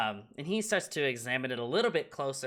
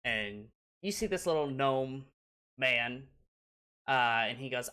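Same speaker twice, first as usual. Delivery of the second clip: usual speaking voice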